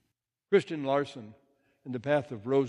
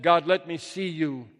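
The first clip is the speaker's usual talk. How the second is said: raised-voice plea to God; loud plea; 3 semitones higher, 6 dB louder than usual. Acted. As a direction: call out, louder than usual. Poem's plea after yelling to God.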